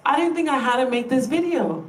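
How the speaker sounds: ordinary talk, nothing marked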